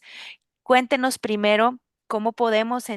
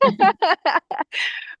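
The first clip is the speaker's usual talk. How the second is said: high bright laugh; 11 semitones higher, 5 dB louder than usual. Real, not acted. Laughs at guest's joke.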